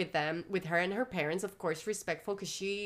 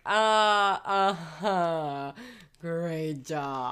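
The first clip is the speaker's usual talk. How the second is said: dry mock praise, louder; sarcastic; 3 semitones lower, 6 dB louder, much slower than usual. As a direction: play the sarcastic officer, unimpressed. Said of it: "So sarcastic".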